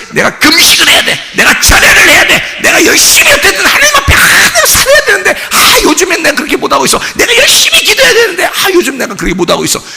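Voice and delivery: very high, strained mock zeal; hammed-up high-pitched self-pressure; overwrought religious striving. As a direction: act out someone frantically driving themselves. Acts out anxious religious striving.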